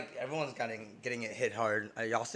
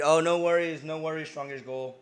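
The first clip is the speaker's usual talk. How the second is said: much louder, higher friendly no worries; loud reassurance; 4 semitones higher, 10 dB louder than usual. Real, not acted. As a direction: brush it off warmly, loudly. Reassuring a chat member.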